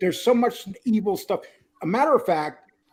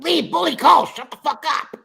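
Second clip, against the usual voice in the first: higher and louder exaggerated scold; mock loud yell; 9 semitones higher, 5 dB louder than usual. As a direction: yell it at Lee, hamming up her anger. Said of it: "you said it loud".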